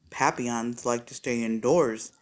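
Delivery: low, gruff dad voice; a low, fatherly voice; deep dad voice. Acted. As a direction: drop the voice for the father.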